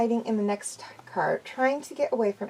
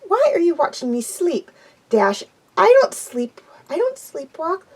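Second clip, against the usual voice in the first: higher, timid pony voice; Fluttershy's voice; 7 semitones higher, 4 dB louder than usual. A narrator's reading. Fluttershy denying she sleepwalks, high and timid.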